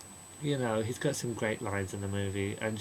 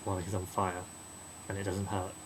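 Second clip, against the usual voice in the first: ordinary talk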